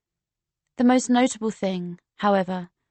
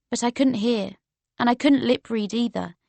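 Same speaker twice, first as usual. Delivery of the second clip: ordinary delivery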